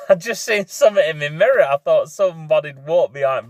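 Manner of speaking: a real startled laugh at his own jumpiness. Real, not acted. Biker startled by a cat in his mirror, laughing.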